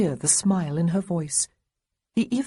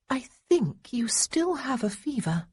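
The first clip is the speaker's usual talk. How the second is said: unsure; 7 semitones higher than usual. A narrator's reading. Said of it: "sounding unsure of herself".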